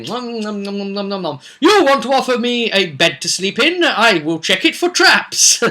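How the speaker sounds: eye every gift as a trap; hammed-up paranoid player character; paranoid adventurer. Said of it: "They're suspicious about everything".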